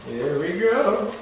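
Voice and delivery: slow and drawn out; slow dramatic. Pretend. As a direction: stretch it like a reveal.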